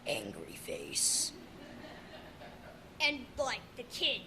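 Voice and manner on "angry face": slow, low unvoiced whisper; mock angry face. Bandaged kid walking with a stick, mock menacing whisper. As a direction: growl it out slowly under your breath. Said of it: "I'm just going".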